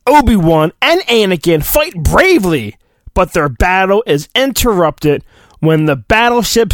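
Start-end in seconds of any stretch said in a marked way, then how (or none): none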